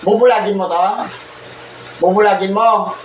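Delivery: ordinary delivery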